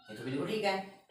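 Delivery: normal talking voice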